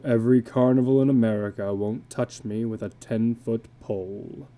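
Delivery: a worn-out carnival man, resigned to bad luck; illustrated man. Illustrated man explains being fired.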